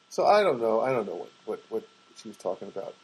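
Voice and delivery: ordinary talk, nothing marked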